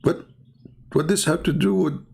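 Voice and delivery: usual speaking voice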